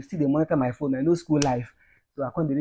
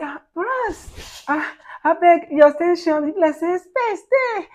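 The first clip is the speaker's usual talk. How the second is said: very high-pitched mimicked girl's voice; mock girl asking a favour, pitched way up; slim girl; 5 dB louder than usual. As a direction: put on a high girlish voice, pleading. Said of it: "one slim girl just enter".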